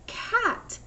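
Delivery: cat said high as one word; blended word. Shown as a demonstration. Teacher blends the chunks into the word, high. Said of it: "blend it together to hear the word cat".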